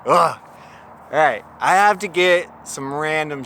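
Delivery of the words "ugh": groan. Real, not acted. Groaning at the reshoot.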